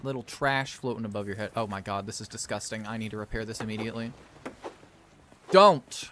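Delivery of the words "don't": loud, high cry of warning; one loud, high "don't"; sharp warning. Acted. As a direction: shout a sudden stop order. Scolding Marcos in game.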